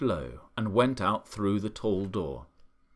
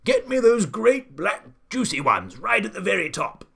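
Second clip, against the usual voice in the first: high, loud, imperious shout; old man shouting; 9 semitones higher, 8 dB louder than usual. A narrator's reading. Old man to gardener up the ladder, shouting. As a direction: bellow the order up to the treetop. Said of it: "the old man was shouting".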